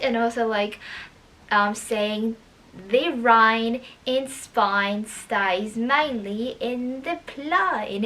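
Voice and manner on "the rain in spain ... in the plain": put-on slow thick Cockney accent; drawn-out Cockney vowels like Eliza. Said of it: "in a thick Cockney accent".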